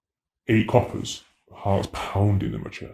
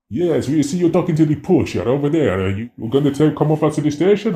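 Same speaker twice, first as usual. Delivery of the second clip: higher, louder stern police officer calling out; mock Italian cop; 7 semitones higher, 5 dB louder than usual. Italian police officer confronting a tourist, loud and stern. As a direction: play the carabiniere catching him out. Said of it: "were like".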